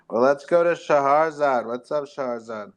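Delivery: ordinary delivery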